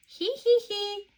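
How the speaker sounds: high spoken giggle; tee-hee like little piglets. Acted. Peppa and George giggling while dressing up. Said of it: "they giggle".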